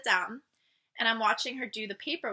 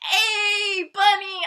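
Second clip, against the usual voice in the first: a higher, louder cheerful hello; happy greeting; 9 semitones higher, 6 dB louder, much slower than usual. Retold or imitated. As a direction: greet your friend with joy. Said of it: "and she's like".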